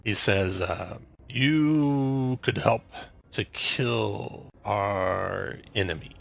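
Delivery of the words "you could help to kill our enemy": lightly voiced slow-witted giant, drawn-out words; dim hill giant. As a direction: labour slowly over each word, lightly in character. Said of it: "the hill giant".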